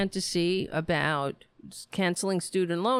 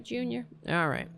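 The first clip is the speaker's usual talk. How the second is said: ordinary talk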